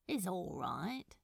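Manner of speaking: soft whispered shrug; whispered aside. Acted. Crowley shrugs off the question in a whisper. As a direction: let it slip out under the breath.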